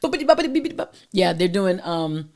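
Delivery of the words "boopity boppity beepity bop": high, bouncy beep-bop babble; high-pitched imitated popping; playful nonsense noises. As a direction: rattle off silly high syllables.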